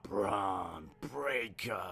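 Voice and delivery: slow, soft, stretched-out name; mock name announcement. Naming the wrestler before his match, slow soft drawl. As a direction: draw out each word under the breath.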